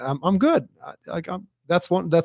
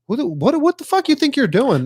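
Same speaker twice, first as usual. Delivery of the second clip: much higher, indignant mock yell; retold outrage; 12 semitones higher, 3 dB louder than usual. Podcaster replays confronting the man who grabbed him, in a much higher indignant mock yell. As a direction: throw it back at the stranger who touched you. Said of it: "And I was like".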